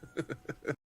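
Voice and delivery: wet Donald Duck squawk. Shown as a demonstration. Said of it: "Here's my Donald Duck impression".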